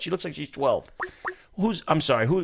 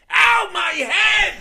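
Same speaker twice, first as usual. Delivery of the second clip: much higher, loud, slow mock shout of pain; JFK impression; 5 dB louder, much slower than usual. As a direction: ham up a yelping Kennedy in the back of the car. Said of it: "this is my J F K impression".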